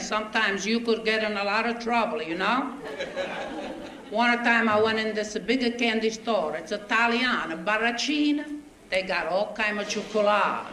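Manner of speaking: put-on broken English with a heavy Italian accent, comic storyteller; a thick Italian accent, told with a grin; Italian immigrant accent. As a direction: play the bewildered Italian newcomer telling his troubles. Stage comic in Italian immigrant dialect.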